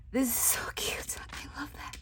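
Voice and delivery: soft, breathy whisper with a laugh; giggly whisper. Real, not acted. Crafter admiring the gift idea, giggly whisper. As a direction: hush it like a secret.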